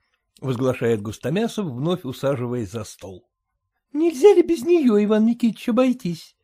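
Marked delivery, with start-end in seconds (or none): 3.6-6.3 much higher, coaxing wife; imploring wife. A narrator's reading. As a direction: talk your husband out of it. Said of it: "обращается к Густомясову жена".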